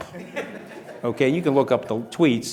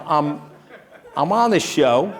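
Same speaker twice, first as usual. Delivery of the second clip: laughing; 4 semitones higher, 5 dB louder, slower than usual. Real, not acted. Laughing as the story starts.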